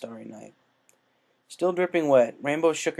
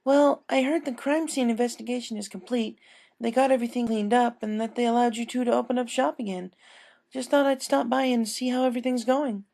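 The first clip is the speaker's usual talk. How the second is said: higher, chatty female voice; casual small talk, pitched up; friendly customer; 12 semitones higher than usual. Acted. A customer checking in on the baker, chatty and high.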